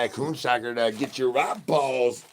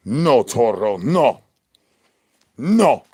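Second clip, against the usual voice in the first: louder, firm no; mock scold, raised voice; 8 dB louder, much slower than usual. Streamer loudly scolding Toro in mock sternness.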